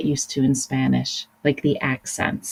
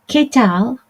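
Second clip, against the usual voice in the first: Qué tal lifted high and bright; casual Spanish greeting, high and lively; 8 semitones higher, 4 dB louder than usual. Shown as a demonstration. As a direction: sing it out like a friendly hello. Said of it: "a fun way of saying".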